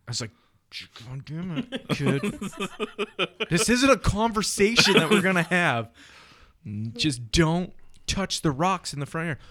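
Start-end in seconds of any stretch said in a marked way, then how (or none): 1.1-9.4 loud, higher exasperated scolding; fed-up father yelling, half laughing; exasperated dad. Retold or imitated. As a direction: at the end of his rope with the kid.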